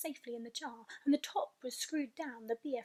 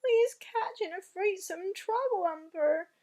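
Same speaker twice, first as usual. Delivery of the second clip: distressed cry; 8 semitones higher, 6 dB louder than usual. Acted. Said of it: "he cried".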